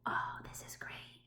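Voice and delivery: breathy hushed aside; whisper. Real, not acted. Teacher whispers her delight at the page.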